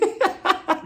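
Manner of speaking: short high laugh. Real, not acted. Vlogger amused by Jennifer, quick high laugh. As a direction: burst out laughing.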